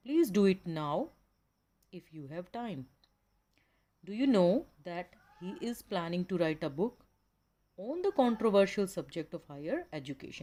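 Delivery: slow demo reading with a clear break before "if", "that" and "on"; tone-group pauses. Shown as a demonstration. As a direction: chunk it slowly, pausing after "now", "time" and "know". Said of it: "यहाँ पोज आते जा रहे हैं".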